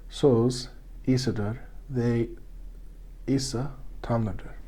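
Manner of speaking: slow, hushed reverent whisper. Real, not acted. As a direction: breathe it out slowly, reverently. Solemn statement of belief.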